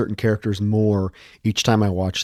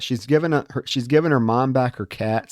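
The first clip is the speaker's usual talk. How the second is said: ordinary delivery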